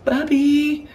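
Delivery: put-on high, loud little-girl call; little sister calling. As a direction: imitate a small girl calling for her brother. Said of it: "she probably goes down to my room".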